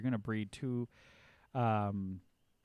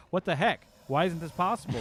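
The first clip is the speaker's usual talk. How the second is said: loud, high imitation of outraged managers; mock indignant; 6 semitones higher, 7 dB louder than usual. Managers confronting developers over a missed date, mock indignant. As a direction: act out a boss demanding answers.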